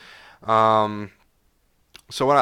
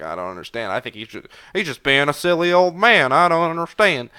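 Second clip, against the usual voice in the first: put-on Southern accent as a baffled kid, partly overdone; mock Southern kid; 5 semitones higher, 7 dB louder than usual. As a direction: play a confused Southern child, lightly and in jest. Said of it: "I did a Southern accent for the kid".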